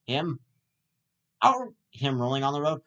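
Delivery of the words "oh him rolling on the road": ordinary delivery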